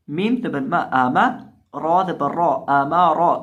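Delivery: loud, chanted letter-by-letter drill; Quran spelling recitation. Acted. Teacher chanting the letters so students repeat.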